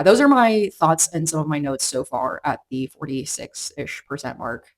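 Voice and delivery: usual speaking voice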